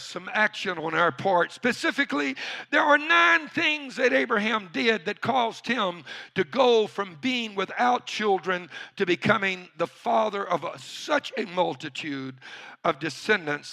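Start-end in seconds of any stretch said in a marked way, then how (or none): none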